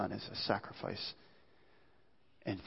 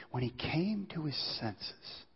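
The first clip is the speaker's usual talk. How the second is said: slow hushed whisper; 3 semitones higher, 8 dB quieter, slower than usual. Real, not acted. Preacher telling of the son's return, whispered slowly. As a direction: drop to a breathless whisper.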